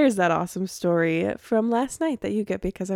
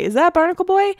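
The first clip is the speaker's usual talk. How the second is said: put-on high, loud call-out of Barnacle Boy; retold loud obnoxious shout at a stranger; 10 semitones higher, 7 dB louder, faster than usual. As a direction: yell it across the bar, brash and bold. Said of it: "I was very loud and obnoxious".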